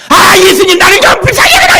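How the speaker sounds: very high, loud, fast pleading yell; frantic shout. Retold or imitated. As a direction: yell with everything left. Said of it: "막 소리 질렀지 않았겠습니까?". (매우 높고 빠르게 절규하는 외침)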